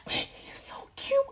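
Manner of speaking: tiny, very high squeaky whisper; mock gushing. New mother shown her baby, mock gushing whisper. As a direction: imitate tearful cooing, soft and slow.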